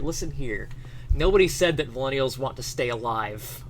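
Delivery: ordinary talk, nothing marked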